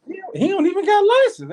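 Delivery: high, laughing protest; incredulous laugh through words; laughing disbelief. Retold or imitated.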